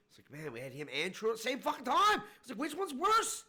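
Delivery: high-pitched, incredulous exasperation; exasperated rant. Real, not acted. Venting to friend about men who can't answer questions, exasperated. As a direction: pitch soars in disbelief.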